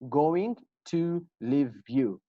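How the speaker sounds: slow, each word spelled out; slow careful reading. Shown as a demonstration. Teacher reading the sentence slowly to students. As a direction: read it word by word. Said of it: "How do we pronounce this".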